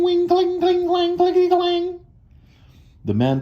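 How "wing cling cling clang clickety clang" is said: very high wing cling clang; machine noises. A narrator's reading. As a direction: chant the computer sounds up high. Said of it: "They go".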